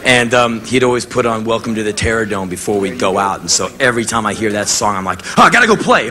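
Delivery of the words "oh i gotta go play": sudden high, loud burst of eagerness; fired-up excitement. Retold or imitated. Hearing Terror Dome before a gig, pumped and loud. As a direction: jump up like the song just hit you. Pumped by the pre-show song.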